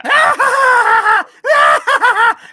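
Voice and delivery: long, loud, very high creature cackle; Salacious Crumb cackle. Acted. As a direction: shriek with mocking imp laughter. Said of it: "that's a terrible Salacious Crumb impersonation".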